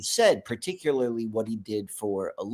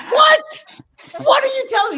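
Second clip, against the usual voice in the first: far higher, louder shriek of disbelief; stunned disbelief; 9 dB louder than usual. Retold or imitated. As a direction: squeal it in shock. Son shocked.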